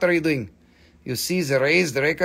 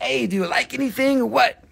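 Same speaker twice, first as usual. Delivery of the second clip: teasing imitation; 6 semitones higher than usual. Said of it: "You're like".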